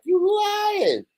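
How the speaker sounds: high-pitched squealed disbelief; mock disbelief. Replaying his reaction to learning she played Jane, high incredulous cry. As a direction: cry it out high, as if hearing unbelievable news. Retold disbelief at surprising news.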